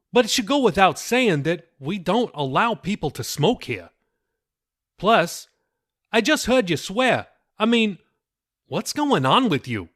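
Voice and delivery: the disappointed dad scolding; stern father. A narrator's reading.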